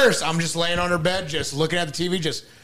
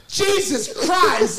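disgusted outburst; 11 semitones higher, much slower than usual. Retold or imitated. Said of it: "I says".

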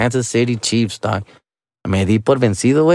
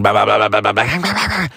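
mock barking. Dad mimicking mom's ten-minute rant, mocking babble. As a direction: imitate endless nagging as meaningless noise. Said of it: "after she fucking barked".

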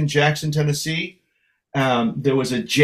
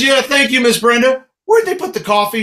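higher, louder eager thanks; eager greeting; 8 semitones higher, 5 dB louder than usual. Retold or imitated. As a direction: gush at meeting a star. Greeting [name], retold.

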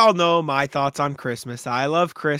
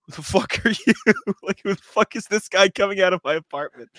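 startled roommate; 5 semitones higher, 3 dB louder than usual. Retold or imitated. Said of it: "the roommate was just like".

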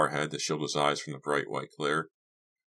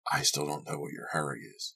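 quiet man's grumble; host, disapproving; 6 dB quieter than usual. Acted. Host to departing guest, quietly disapproving. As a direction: softly question his hurry, disapproving. Narrator voices Lou grumbling.

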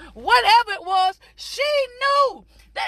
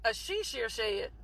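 much lower, much softer, deadpan retelling; unexcited; 10 semitones lower, 13 dB quieter than usual. Retold or imitated. Husband hearing about the she shed, unexcited. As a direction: imitate someone barely interested. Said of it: "He wasn't too excited".

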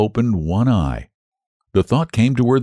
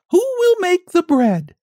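very high, sung-out hen call; confident hen call. Acted. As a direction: sing it out high and sure. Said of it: "she sang out".

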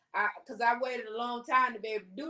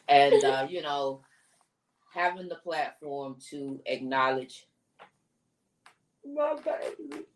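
holding back tears; 7 semitones lower, 4 dB louder than usual. Real, not acted. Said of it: "I'm trying not to cry".

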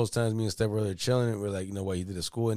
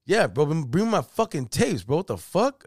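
high-pitched imitated exasperation; hammed-up impatient caller, voice swooping high; exasperated demand; 6 semitones higher, 4 dB louder than usual. Brother insists on his tapes, imitated high exasperation.

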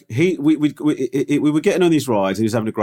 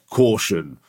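ominous ride warning; 4 semitones lower than usual. Retold or imitated. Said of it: "they always do this thing".

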